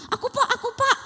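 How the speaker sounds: put-on high, eager child's hand-up cry; a pupil bursting to answer. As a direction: Mira volunteering eagerly, very high. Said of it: "kata si Mira dengan semangat".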